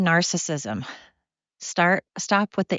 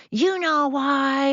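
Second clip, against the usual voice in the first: menacing movie quote; 9 semitones higher, much slower than usual. Retold or imitated. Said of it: "she's like".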